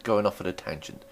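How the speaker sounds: usual speaking voice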